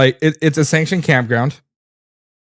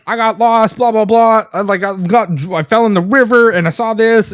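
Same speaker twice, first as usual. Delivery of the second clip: put-on girl's voice; 6 semitones higher than usual. Girl voiced in retold story.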